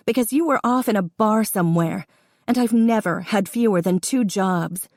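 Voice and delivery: confronting her father, voice rising toward tears; rising anger. Acted. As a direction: let the hurt climb into a cry. Daughter confronts father, building up.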